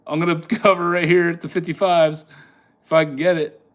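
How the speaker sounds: ordinary delivery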